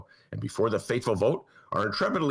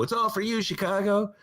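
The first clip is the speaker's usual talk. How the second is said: high, grand press conference voice; hammed-up civic promise; mock politician; 9 semitones higher than usual. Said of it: "hold a press conference and go".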